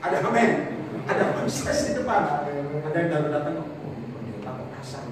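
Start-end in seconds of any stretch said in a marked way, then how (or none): 3.6-5.0 whispered complaint. Retold or imitated. Newcomer murmurs about harsh pastor.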